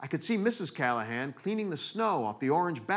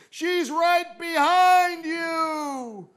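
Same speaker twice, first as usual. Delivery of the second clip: very slow, high, stretched-out warning yell; hammed-up slow-motion movie cry; slow-motion shout; 11 semitones higher, 3 dB louder, much slower than usual. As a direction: drag every vowel out as if time slowed. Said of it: "I remember in slow motion".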